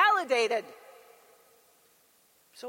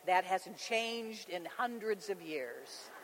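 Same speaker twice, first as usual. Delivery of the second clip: usual speaking voice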